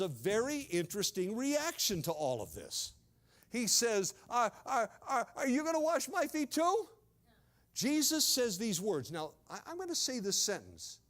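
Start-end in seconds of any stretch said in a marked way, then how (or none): 4.3-6.9 stammering disbelief. Acted. Peter stunned at Jesus washing feet.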